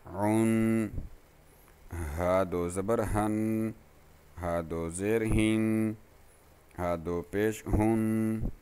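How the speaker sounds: slow, even sing-song spelling chant; recitation drill. Shown as a demonstration. Teacher drilling students on tanween sounds, in an even sing-song chant. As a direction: spell out each letter like a patient Qaida teacher.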